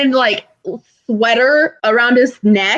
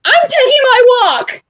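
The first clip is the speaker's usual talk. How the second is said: high, huffy declaration; huffy exit; 13 semitones higher, 3 dB louder than usual. Retold or imitated. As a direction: storm off in a mock huff. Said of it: "I'm like".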